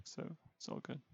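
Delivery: breathy whisper, no voice. Real, not acted. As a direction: whisper it softly. Whispered reassurance.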